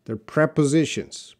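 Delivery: prepositions as PREP-uh-ZI-shunz, first syllable prep; prepositions said clearly. Shown as a demonstration. Teacher corrects a student, spelling out prepositions. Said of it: "they're not propositions".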